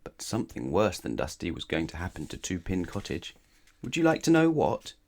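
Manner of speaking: usual speaking voice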